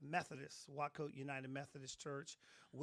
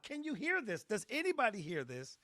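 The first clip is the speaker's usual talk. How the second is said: higher, louder, asking the room; retelling a startled cry to the room; urgent calling out; 7 semitones higher, 6 dB louder than usual. Retold or imitated. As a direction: call out in shock to everyone around. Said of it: "I'm saying out loud".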